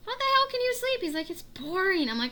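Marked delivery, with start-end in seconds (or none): 0.1-1.0 high, loud exasperated scold; mock outrage. Sister scolding brother who fell asleep in the movie, loud disbelief. 1.3-2.1 higher, louder mock-bored brother. Brother explaining why he slept in the movie, mock bored.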